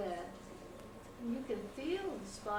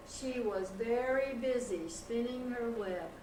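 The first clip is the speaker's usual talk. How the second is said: plain reading voice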